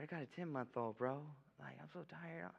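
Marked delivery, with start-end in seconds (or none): 1.6-2.5 much softer, drained "so tired"; tired sigh. Real, not acted. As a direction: let it sag out, worn down.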